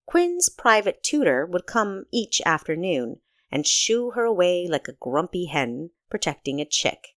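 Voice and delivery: ordinary delivery, nothing marked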